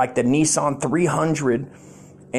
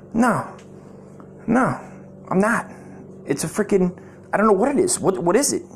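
higher-pitched, halting denial; acted protest; 5 semitones higher than usual. Husband accused of sleeping around, acted higher-pitched protest. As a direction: plead innocence in short, higher bursts.

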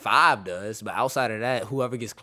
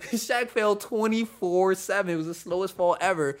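laughing; 9 semitones higher, 5 dB louder than usual. Real, not acted. Laughing about Shaq falling.

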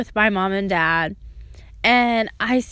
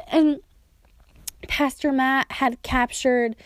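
normal talking voice

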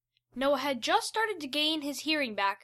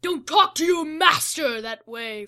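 loud commanding monster voice; booming villain; 4 semitones higher, 8 dB louder than usual. Acted. Fraser scolding Liliana, booming. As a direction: boom it down at them. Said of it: "Fraser boomed".

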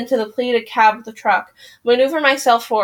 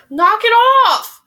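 angry shout; 11 semitones higher, 5 dB louder than usual. Real, not acted. Scolding friend near candle.

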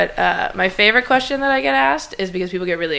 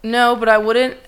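normal talking voice

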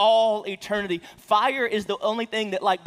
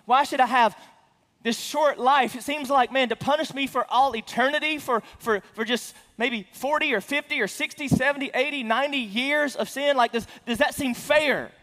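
higher and louder mock protest; skeptic's objection; 7 semitones higher, 5 dB louder than usual. Preacher voicing a skeptic who says hell is unfair, high and loud. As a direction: whine it like an indignant doubter. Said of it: "And people think".

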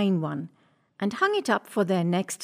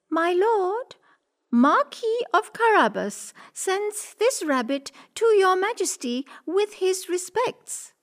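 much higher courtly cat voice; 10 semitones higher than usual. Acted.

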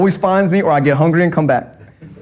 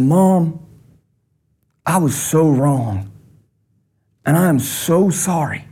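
remorseful apology; slower than usual. Retold or imitated. Confessing to mom remembered.

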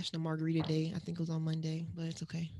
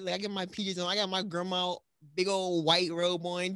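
normal talking voice

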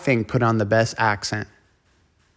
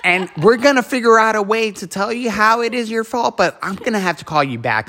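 mock lender; 9 semitones higher than usual. Mocking the lender's reasoning.

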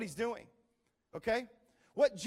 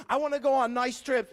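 usual speaking voice

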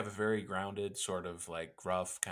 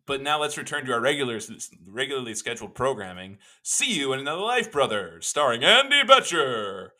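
louder, higher show-title announcement; announcer voice; 5 semitones higher, 11 dB louder than usual. Acted. Host returning to the regular show, big announcer read. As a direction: boom out the show name like a radio announcer.

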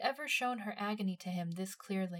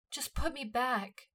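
slow, higher pleading; begging; 5 semitones higher, slower than usual. A narrator's reading. Himmel begging Levi to put her back, slow plea. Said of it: "she begged".

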